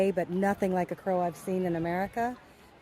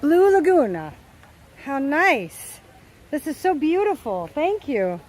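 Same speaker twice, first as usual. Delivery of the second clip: loud, bright outdoor excitement; thrilled to show off the lagoon; loud delighted tour-guide voice; excited; 3 semitones higher, 5 dB louder than usual. Real, not acted.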